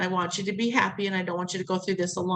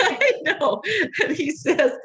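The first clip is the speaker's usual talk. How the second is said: laughing; 7 semitones higher, 4 dB louder, slower than usual. Real, not acted. Laughing with the listener.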